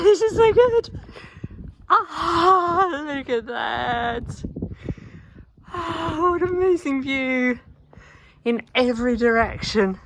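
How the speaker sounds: high-pitched, drawn-out wonder at a view; awed delight. Real, not acted. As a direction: gasp and marvel, stretching the words out high.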